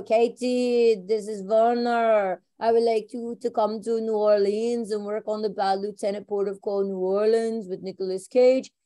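lightly put-on German accent, unhurried; a hint of a German director on the phone. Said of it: "in his German accent".

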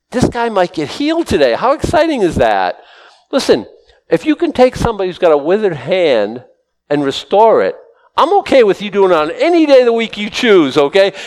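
high-pitched, eager excitement; excited. Real, not acted. Preacher imagining a withered hand restored, excited and high. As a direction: pitch up, thrilled at the very idea.

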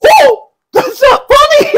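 high, loud laughing shout; laughing alarm. Retold or imitated. Kid realizing prank backfired.

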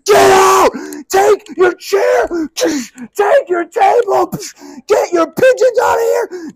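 screaming high and loud, voice cracking; furious shout. Retold or imitated. Jesus driving the sellers out, with a high cracking furious shout. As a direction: roar them out of the temple. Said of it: "he's like".

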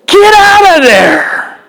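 impassioned plea. Acted. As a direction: beg him to flee. Urging Samson to flee.

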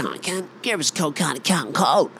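high-pitched Aussie larrikin demand; high, slurred Aussie beer order; broad Aussie order. Acted. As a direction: badger the drive-through bloke in thick Aussie. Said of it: "and you go".

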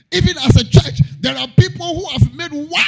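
high, loud cry to the church; shouted preaching. Real, not acted. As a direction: call it out over the hall. Shouting sermon.

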